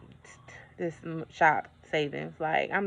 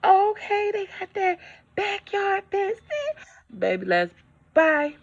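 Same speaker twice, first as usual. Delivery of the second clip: higher, excited house-hunter voice; mock eager homebuyer; 7 semitones higher than usual. Mimicking buyers to her viewers, gushing high. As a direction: gush over the house like a couple.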